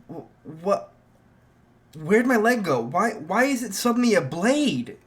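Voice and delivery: high, loud panicked questions. Acted. Izuku shouting at his own leg in panic, high and loud. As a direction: voice climbing as he panics over the lost leg.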